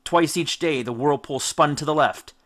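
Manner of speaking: plain reading voice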